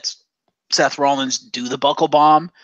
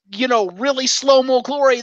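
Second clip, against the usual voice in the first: ordinary delivery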